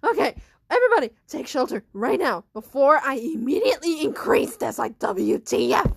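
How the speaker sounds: very loud, urgent principal; shouted orders. Acted. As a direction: bellow an order to everybody.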